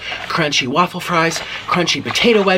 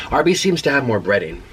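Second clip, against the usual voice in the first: usual speaking voice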